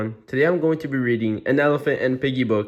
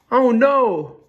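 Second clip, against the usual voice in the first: loud, high, dismayed exclamation; alarmed cry; 14 semitones higher, 7 dB louder than usual. Acted. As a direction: cry out in hammed-up panic. Gerald reacts with alarm.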